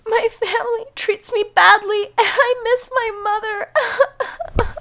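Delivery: high, slow, tearful; crying. Acted. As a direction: sob out your sorrow. Cinderella crying to helper.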